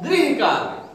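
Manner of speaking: गृह said clearly as "grih" with the ri vowel; correct pronunciation. Shown as a demonstration. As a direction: stress the ri in गृह, not gra. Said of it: "ये क्या होता है?".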